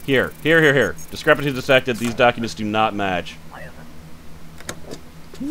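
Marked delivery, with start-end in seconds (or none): 3.5-5.5 whispered disbelief. Real, not acted. Confused at discrepancy.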